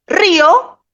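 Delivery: "río" in two syllables, high stressed RÍ then o. Shown as a demonstration. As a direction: punch the first vowel of "río". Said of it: "un hiato".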